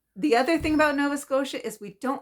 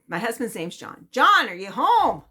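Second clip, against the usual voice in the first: neighbor characterization; 3 semitones higher, 7 dB louder than usual. Retold or imitated. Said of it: "I'll try to do a little characterization".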